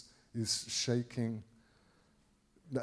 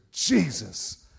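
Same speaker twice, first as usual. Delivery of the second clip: sudden loud cry of command; explosive shout; 8 dB louder than usual. Retold or imitated. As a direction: blast the name out at the lunging attacker. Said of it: "Like, I blew the mic up".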